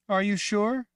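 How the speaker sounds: put-on high, loud young girl voice; childlike Rachel. Girl Rachel asking the woman, high and loud. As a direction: sound like a scared little girl.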